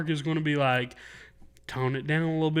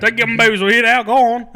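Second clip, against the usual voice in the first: higher, louder country shooing; shooing a crowd; 6 semitones higher, 8 dB louder than usual. Retold or imitated. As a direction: shoo them off like an old showman. Echoing the same shooing quote.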